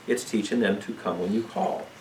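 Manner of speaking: normal talking voice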